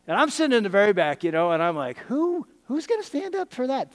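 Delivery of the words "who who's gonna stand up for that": mock disbelief. Incredulous thought at the altar call.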